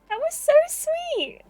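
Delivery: very high, drawn-out squeal; hammed-up sweet sniffler; tearful gushing. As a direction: gush in a high, weepy character voice. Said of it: "[name] sniffles loudly".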